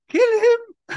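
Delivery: very high, loud mock shriek; giggling maniac. Gassed Dreyfus ordering Clouseau killed, high maniacal squeal. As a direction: squeal it like a giddy villain. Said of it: "he's still giggling maniacally".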